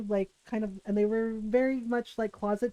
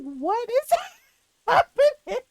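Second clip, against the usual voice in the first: laughing disbelief; 11 semitones higher, 6 dB louder, slower than usual. Retold or imitated. Bewildered girlfriend recalling scene.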